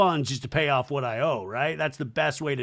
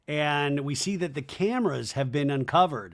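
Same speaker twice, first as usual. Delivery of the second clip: usual speaking voice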